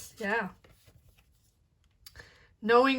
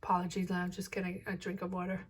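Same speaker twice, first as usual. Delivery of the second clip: hoarse whisper, voice almost gone; rasping, losing her voice; raspy whisper; 2 semitones lower, 7 dB quieter, much faster than usual. Real, not acted. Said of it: "I'm losing my voice".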